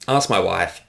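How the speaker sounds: normal talking voice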